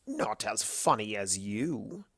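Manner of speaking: soft, slow, mushy croon. A narrator's reading. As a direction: coo it stupidly sweet. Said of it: "using the mushiest voice he could muster".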